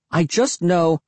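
high-pitched, moaning protest. A narrator's reading. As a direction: keep moaning in distress. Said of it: "I moaned".

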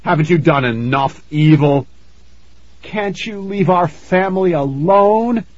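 high, forceful mother's protest; angry demand. A narrator's reading. Mother confronts the ghost, demanding angrily. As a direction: demand it, pitch climbing on the plea. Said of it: "Missus Rowland demanded".

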